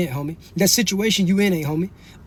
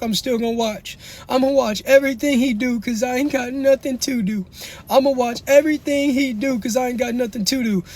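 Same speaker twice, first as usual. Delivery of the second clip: higher-pitched mocking viewer voice; mimicked viewer; 5 semitones higher than usual. Streamer mimics viewers who refuse to leave, mimicked viewer. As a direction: lift the voice to parody a stubborn onlooker. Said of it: "a lot of y'all sitting around saying".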